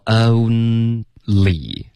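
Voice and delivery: only drawn out with a clear l; teacher models only; slow clear only. Shown as a demonstration. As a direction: stretch only. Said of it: "这刚刚发成only了".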